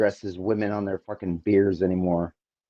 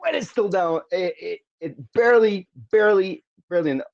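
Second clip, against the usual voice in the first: excited shout; 8 semitones higher than usual. Real, not acted. Live kick, ball barely misses.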